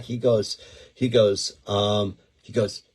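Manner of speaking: normal talking voice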